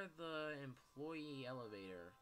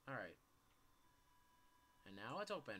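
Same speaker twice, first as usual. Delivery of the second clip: usual speaking voice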